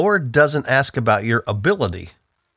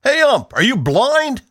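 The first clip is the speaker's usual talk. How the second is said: higher, louder ballpark yell; dad shouting at the ump; 11 semitones higher, 3 dB louder than usual. Retold or imitated. Dad at a Phillies game yelling at the ump. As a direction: holler it at the umpire like an angry fan. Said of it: "I can still hear him shouting".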